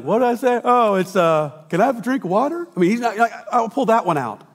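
high, loud, scatterbrained preacher fumbling for a sermon; mock forgetful preacher. Preacher mocking himself for a pastor fumbling his notes. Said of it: "and be like".

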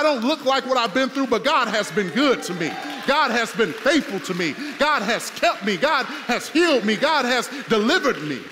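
loud, ringing preacher's shout rising through each line; preaching at full force. Acted. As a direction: drive it home like a pulpit climax. Preacher builds to a climax.

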